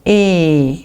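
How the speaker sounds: E held out as one long vowel. Shown as a demonstration. Teacher shows learners how E is pronounced, long E. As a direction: draw the E out steadily. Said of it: "mais nous prononcerons E".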